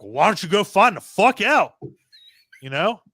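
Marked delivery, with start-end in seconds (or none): 0.0-1.7 annoyed order. Retold or imitated. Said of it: "He's like".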